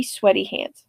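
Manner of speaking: ordinary talk, nothing marked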